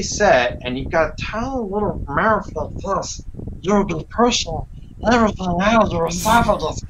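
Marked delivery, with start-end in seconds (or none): 1.2-6.8 a lightly voiced, higher, strained heavy-set man pushing words up from the throat; a hint of a cramped, breathless cartoon voice; Andre voice demo. Shown as a demonstration. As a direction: squeeze it out past a tiny mouth, half-demonstrated. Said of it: "that's where I came up with his voice".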